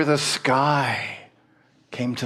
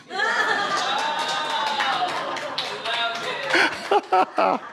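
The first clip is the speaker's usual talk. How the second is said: long laugh. Real, not acted. Laughing at own punchline.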